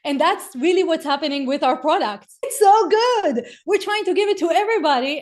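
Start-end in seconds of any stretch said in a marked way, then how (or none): 2.4-5.2 gushing. Acted. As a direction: swoon over it like the tastiest bacon. Gushing about the product.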